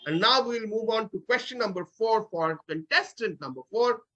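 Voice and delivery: ordinary talk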